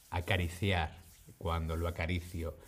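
hushed, tender phrasing; soft murmur. Shown as a demonstration. Teacher cooing about petting her kitten. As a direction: say it softly as if petting a cat. Gentle cat petting example.

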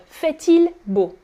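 T of FAIT sounded before IL; liaison in FAIT-IL. Shown as a demonstration. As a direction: slowly link FAIT-IL with a T. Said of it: "you're making the liaison".

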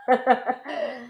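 short chuckle; brief real laugh after a remark. Real, not acted. Teacher amused at asking for four words, laughing.

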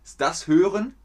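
playful high check; rising quiz question. Shown as a demonstration. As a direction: lift the voice on "Hören" like a quiz. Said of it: "Was ist Hören?".

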